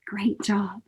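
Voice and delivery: soft whisper; whispered praise. Real, not acted. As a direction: praise them in a hushed whisper. Quiet praise after breathing exercise.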